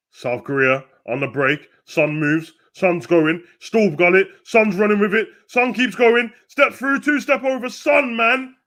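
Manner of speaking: building from brisk play-by-play to high, loud excitement; rising excitement. Real, not acted. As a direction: call the run like a hyped-up commentator.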